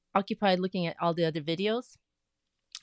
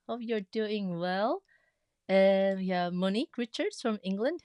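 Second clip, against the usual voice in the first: usual speaking voice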